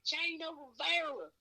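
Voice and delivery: very high-pitched mock hollering; fans calling out. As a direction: imitate eager fans yelling for attention. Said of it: "everyone's calling me".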